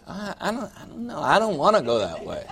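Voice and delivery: a stubborn driver balking, half-laughing; petulant mumbled excuse; whiny refusal. Retold or imitated. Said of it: "And you're gonna say".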